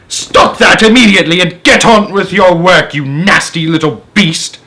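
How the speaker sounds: loud, high angry command; ordering. Acted. Sponge ordering James back to work, loud and angry. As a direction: bark the order at him. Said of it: "Aunt Sponge ordered".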